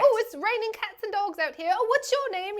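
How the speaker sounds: high, loud mock hippie voice; playful impression of a contestant; imitating the character. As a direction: ham up the airy chakra lady. Re-enacting the clip's character.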